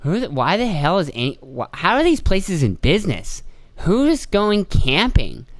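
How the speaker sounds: lightly voiced baffled boss; incredulous boss. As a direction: drag it out like he can't believe it. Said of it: "and he goes".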